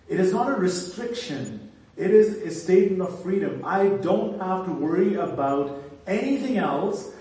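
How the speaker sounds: fervent preaching. Acted. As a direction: preach it with rising conviction. Preaching at full force.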